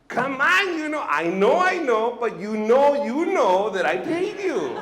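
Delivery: loud, high frustrated pleading; exasperated protest. Retold or imitated. Nephew protests to Tio Chuy, loud and exasperated. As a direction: push back, fed up.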